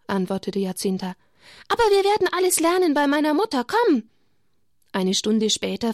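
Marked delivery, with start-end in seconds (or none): 1.7-4.1 put-on high, fast and louder child's urging; eager girl. Jacinta urging Lucia to come learn, fast and high. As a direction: hurry her friend along.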